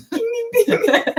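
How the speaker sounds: high-pitched mock alarm dings; playful warning bell. Friend joking about a sarcasm warning, playful high dings.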